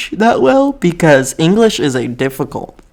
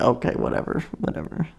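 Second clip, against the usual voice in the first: dismissive aside; 9 dB quieter, faster than usual. Retold or imitated. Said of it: "we would just be like".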